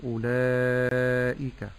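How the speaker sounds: أولئك drawn very slowly, the madd stretched four or five counts; tajweed demo. Shown as a demonstration. Sheikh modelling أولئك with the connected madd, very slow. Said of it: "فأقول".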